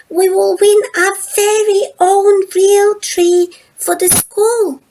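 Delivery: high, loud rallying call to the class; excited Lola. A narrator's reading. Lola urging her schoolmates, excited rallying call. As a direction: rouse the whole school to save the trees. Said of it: "Lola says".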